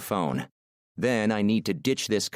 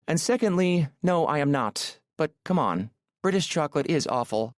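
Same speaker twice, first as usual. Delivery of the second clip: put-on high, accented woman's voice; Aurora, indignant; 7 semitones higher than usual. Woman arguing with her driver about chocolate, playfully indignant. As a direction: protest in a high, melodious voice.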